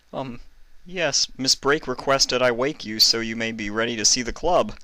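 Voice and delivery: a touch of fear; nervous. A narrator's reading. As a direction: answer timidly, bracing for a yell. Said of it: "afraid he would be yelled at".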